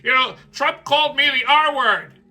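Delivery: lightly voiced mock governor; mocking Walz. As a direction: press conference parody, a touch whiny. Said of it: "he's saying".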